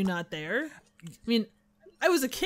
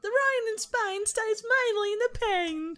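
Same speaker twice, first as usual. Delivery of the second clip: slow, much higher, plummy elocution; mock posh English; 11 semitones higher than usual. Riffing on English types, slow mock posh. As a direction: parody a fussy elocution lesson.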